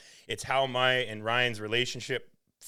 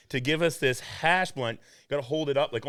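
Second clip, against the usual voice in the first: ordinary talk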